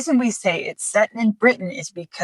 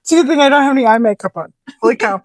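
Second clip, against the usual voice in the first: fast, laughing through tears; gasping after a laughing fit; 4 semitones higher, faster than usual. Real, not acted. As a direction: still crying with laughter. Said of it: "I needed that".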